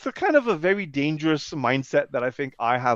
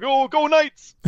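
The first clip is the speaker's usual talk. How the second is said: high, louder mock cheering; fan cheer; 12 semitones higher, 6 dB louder, much slower than usual. Fans cheering when the player came on, excited. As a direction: cheer on the team as a retold fan.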